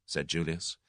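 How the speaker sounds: ordinary delivery, nothing marked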